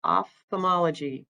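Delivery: "Ophthalmology" with an F sound, then TH; "Ophthalmology" broken carefully after the opening OFF; careful correct pronunciation. Shown as a demonstration. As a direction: sound out "Ophthalmology" for learners, OFF-thuh-MOL-uh-jee. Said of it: "how do you pronounce it?".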